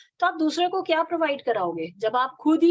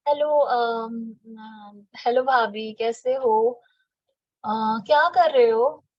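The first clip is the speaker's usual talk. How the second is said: slow motion; 2 semitones lower, slower than usual. Shown as a demonstration. Calling bhabhi on the phone, in dull slow motion. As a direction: stretch every word out lazily, as if you hardly care. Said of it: "चलिए इसको slow motion में लेके चलते हैं".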